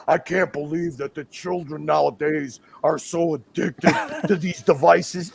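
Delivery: mock sanctimony. Mock quote of a moralizing opponent.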